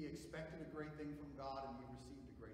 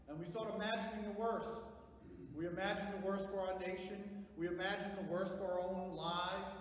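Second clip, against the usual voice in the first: raised, insistent sermon voice; forceful preaching; 7 semitones higher, 10 dB louder than usual. Acted. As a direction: press each point on the congregation, louder.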